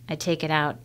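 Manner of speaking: ordinary delivery, nothing marked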